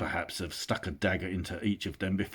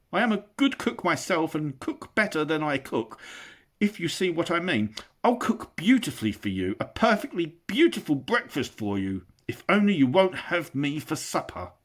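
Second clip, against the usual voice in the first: high, begging voice; pleading hobbit; 9 semitones higher, 5 dB louder than usual. Acted. Bilbo begs the trolls not to cook him, high. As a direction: beg desperately for his life.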